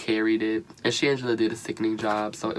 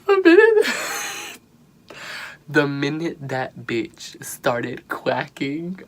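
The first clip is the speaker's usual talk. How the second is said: squeaky laugh breaking into slow words; can barely get it out for laughing; laughing hard; 4 semitones higher, 4 dB louder, slower than usual. Real, not acted. Recapping Morgan's awful quacking, laughing hard.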